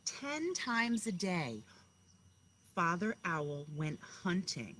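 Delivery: slow, rhythmic read-aloud voice; storybook narration, each line weighted; story reading to children. Acted. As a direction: read it like a bedtime picture book to kindergartners. Teacher reads picture book aloud.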